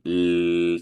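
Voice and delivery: "L-" held as a steady vibrating hum; voiced L sound. Shown as a demonstration. Teacher showing vibration in the vocal tract, "L-" held and voiced. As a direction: sustain "L-" so the vibration is felt. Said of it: "sientes la vibración en".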